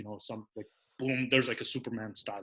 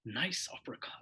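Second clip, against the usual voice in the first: hushed, near-whispered calm call; calm commentary; 9 dB quieter than usual. Retold or imitated. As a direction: imitate yourself calling it very softly. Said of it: "because I say it so calmly".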